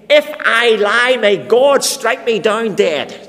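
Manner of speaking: very loud, slow self-curse; solemn curse. Acted. Peter invoking a curse on himself before the crowd, loud and slow. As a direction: swear it like your life depends on it. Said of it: "Peter is in effect saying".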